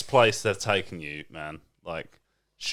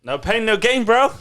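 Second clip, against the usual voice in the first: mock bro; 10 semitones higher, 10 dB louder than usual. Mocking bro encouragement.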